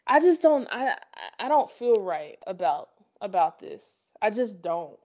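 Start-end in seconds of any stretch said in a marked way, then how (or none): none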